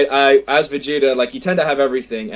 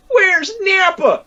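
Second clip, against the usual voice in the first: high, slow excited fan cry; a fan calling out for Nappa; 4 dB louder, much slower than usual. Retold or imitated. Fan runs up to Vegeta cosplayer, excited shout. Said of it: "people would run up to him and go".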